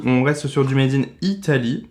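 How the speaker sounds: ordinary talk, nothing marked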